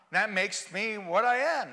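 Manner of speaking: ordinary delivery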